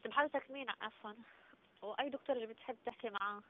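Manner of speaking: thin phone line voice; over the phone. Real, not acted. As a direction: heard through a phone speaker. Doctor's voice heard via phone.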